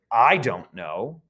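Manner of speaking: "I" drawn out and leaned on; stress on I. Shown as a demonstration. Teacher showing English subject emphasis, stress on "I". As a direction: lean slowly on the word "I". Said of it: "To emphasize your subject".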